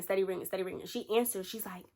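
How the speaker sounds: usual speaking voice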